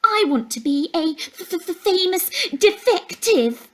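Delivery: high, stammering mimic of a girl; bully mimicking a classmate's voice; mocking girl imitation. A narrator's reading. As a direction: sneer it in a squeaky copy of her voice. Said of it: "Kevin Rutledge mimicked the girl's voice".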